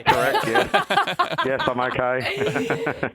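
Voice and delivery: laughing through the words; laughing reply. Real, not acted. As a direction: answer while cracking up. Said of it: "Cough if you're okay".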